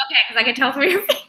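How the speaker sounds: quick words broken by laughter; laughing. Retold or imitated. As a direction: laugh through the line. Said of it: "I was like".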